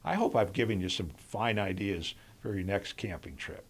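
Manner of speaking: ordinary talk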